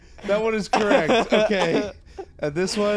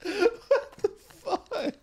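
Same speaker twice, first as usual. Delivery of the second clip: laughing; 7 semitones higher, slower than usual. Real, not acted. Laughing at confusing list.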